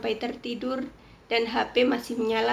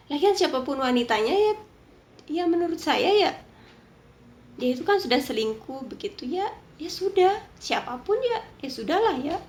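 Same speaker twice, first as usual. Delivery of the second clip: heated opinion; 4 semitones higher, 4 dB louder than usual. Real, not acted.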